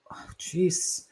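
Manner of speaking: soft unvoiced whisper to herself; embarrassed whisper. Real, not acted. Comic forgetting a colleague's name, whispering to herself. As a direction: breathe it out under your breath.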